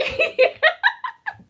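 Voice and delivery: a burst of high, loud delighted laughter; squealing high laugh. Real, not acted. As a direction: laugh out, giddy and overwhelmed. Overcome by the student's words.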